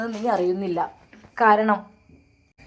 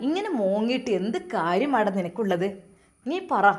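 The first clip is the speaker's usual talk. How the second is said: plain reading voice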